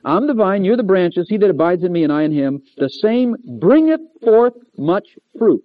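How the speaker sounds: "Abide" called out high and loud; preacher thundering the verse; loud proclaimed verse. Acted. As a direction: boom "Abide in me" to the room. Preaching scripture at full force.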